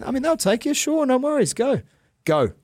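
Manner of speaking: high-pitched, worked-up send-off; ranting dismissal. Real, not acted. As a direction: wave them out the door, fed up. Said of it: "Rant over".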